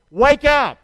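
loud, firm wake up; loud call. Shown as a demonstration. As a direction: call it out strongly. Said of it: "Say it with me".

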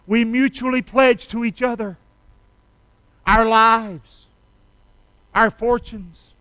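loud, ringing recital fading at the end; solemn recitation. Acted. Preacher reciting pledge to congregation, solemn. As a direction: proclaim the pledge, then let it fall.

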